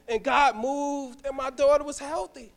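usual speaking voice

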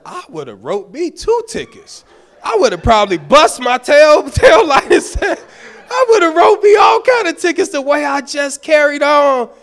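high, loud laughing tirade about his own behavior; loud self-mocking rant. Pastor recounting what he said to the officer, loud laughing rant. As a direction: shout it, half laughing at yourself. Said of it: "I was acting a fool".

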